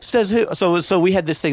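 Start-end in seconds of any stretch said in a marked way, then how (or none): 0.0-0.5 higher, defiant little-brother retort; mock sassy comeback; kid's defiance. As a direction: talk back like a stubborn kid. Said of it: "I'm like".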